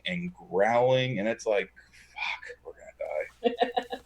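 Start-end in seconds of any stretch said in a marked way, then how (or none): 2.1-4.0 slow, breathless whispered dread; scared whisper. Retold or imitated. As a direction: whisper it as if the dog might hear. Scared of growling dog in truck.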